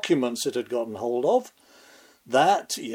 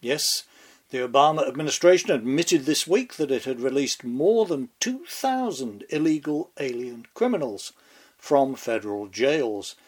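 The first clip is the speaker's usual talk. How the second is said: ordinary delivery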